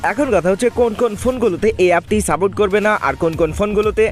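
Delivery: ordinary talk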